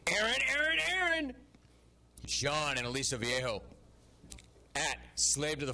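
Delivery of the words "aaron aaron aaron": much higher, louder call of the name. Real, not acted. Host calls out to a caller who went silent, loud and high. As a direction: call him back onto the line. Calling muted caller on air.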